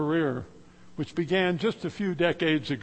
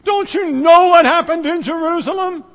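incredulous outburst; 9 semitones higher, 8 dB louder than usual. Acted. Disciples incredulous at stranger.

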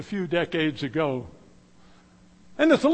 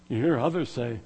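usual speaking voice

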